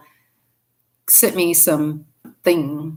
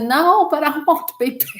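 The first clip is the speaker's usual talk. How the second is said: pleading; 9 semitones higher than usual. Retold or imitated. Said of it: "I said".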